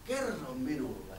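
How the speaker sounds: slow, low, sly wolf voice. Acted. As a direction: coax the boy, drawing it out. Wolf speaks slowly.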